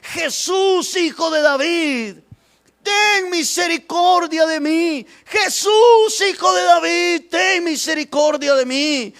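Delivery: high-pitched, urgent cry for mercy; shouting. Acted. Blind man unsure how far Jesus is, shouting out to him. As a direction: raise the voice to reach someone unseen. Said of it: "levanta la voz y empieza a gritar".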